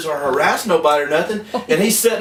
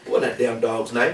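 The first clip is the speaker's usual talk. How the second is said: ordinary talk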